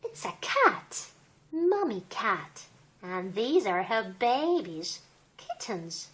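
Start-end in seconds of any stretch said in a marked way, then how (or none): none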